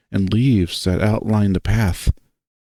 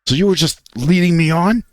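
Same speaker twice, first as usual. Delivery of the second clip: voice breaking; 6 semitones higher than usual. Acted. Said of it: "I feel my voice break as it rises in volume".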